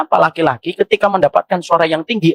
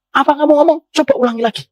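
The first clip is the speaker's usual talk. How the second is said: mock angry snap; 8 semitones higher, faster than usual. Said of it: "suaminya atau istrinya ngomong".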